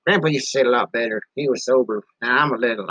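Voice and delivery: usual speaking voice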